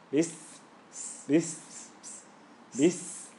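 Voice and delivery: "this" said as "bis", ending in a long hiss; short b, then sustain the s in "this"; held s. Shown as a demonstration. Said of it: "because of the scene".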